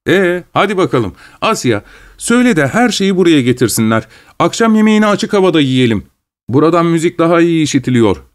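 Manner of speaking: hospitable Gagin. Acted. Gagin sends Asya for supper. (hafifçe tiz, hevesli ev sahibi sesi)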